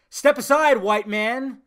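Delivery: loud, high mocking announcement; mock proclamation. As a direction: call it out like a sarcastic decree.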